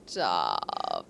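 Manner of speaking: normal talking voice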